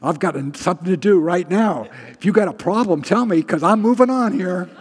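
loud, high, brusque send-off; brisk impatience. Acted. Preacher showing how badly he lingers, loud comic impatience. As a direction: hurry them along, acting too busy. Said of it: "'cause I don't linger well".

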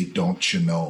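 cartoon Midwestern mom accent, played low-key; upper Midwest accent. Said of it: "a thick upper Midwest accent".

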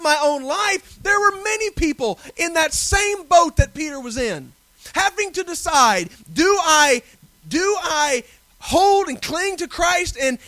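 loud, driving sermon climax; full-force preaching. Acted. Preacher to his congregation on persecution, loud and driving. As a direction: hammer it home to the congregation.

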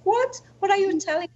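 high, loud surprised question; startled disbelief. Retold or imitated. Her sister at the bedside, shocked and high-pitched. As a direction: jolt up in confusion. Sister baffled at hospital bed.

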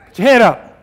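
high, loud, rousing order; preacher's ringing call; loud command. Shown as a demonstration. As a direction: shout it up to lift their chins. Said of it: "I'd like to just do this".

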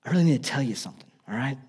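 Mary pulls Joseph aside at dinner, hushed and nervous; mock hushed whisper. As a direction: lean in and murmur it, fast on the middle. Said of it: "Mary whispers over to Joseph".

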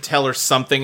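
ordinary talk, nothing marked

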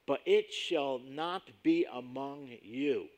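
slow, every word weighed; slow emphatic scripture reading. Shown as a demonstration. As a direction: lay down the line with slow, heavy emphasis. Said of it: "this is very emphatic in the original text".

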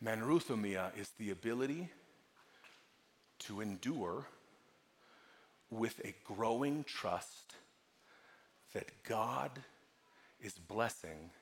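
low, soft teaching with long pauses; hushed preaching. Real, not acted. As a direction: land each phrase gently and gravely. Hushed teaching on the Greek word.

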